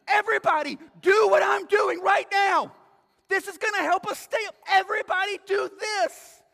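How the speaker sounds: high, loud rallying shout; frantic insistence. Acted. Sweating passenger urges everybody to help keep plane up, frantic. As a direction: shout urgent orders to the whole cabin.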